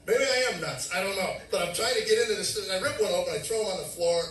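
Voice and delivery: loud, high agitated retelling; frantic outburst. Retold or imitated. Man struggling to open tapes, frantic retelling.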